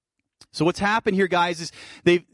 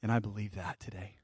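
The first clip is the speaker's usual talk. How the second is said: soft declaration; 7 semitones lower, 13 dB quieter than usual. Real, not acted. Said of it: "I'm just declaring it to you".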